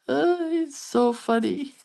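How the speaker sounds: high, drawn-out, coming out of a laugh; laughing fondly as you speak; real amused laughter. Real, not acted. Cracking up at grandson's story.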